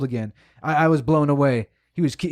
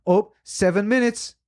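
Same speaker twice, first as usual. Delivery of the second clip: higher, whiny mock of her countdown; mocking impatient woman; 6 semitones higher than usual. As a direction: mimic her nagging about the clock, pitched up.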